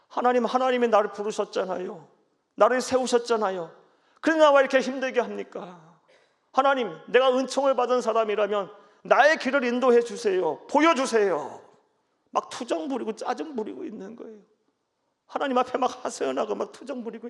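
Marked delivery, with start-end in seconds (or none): 0.1-11.6 a hint of whiny complaint; Moses complaining. Moses whining to God, lightly acted. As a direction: plead to God like a sulking child. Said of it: "쉽게 설명드리면요 … 투정 부리는 거예요".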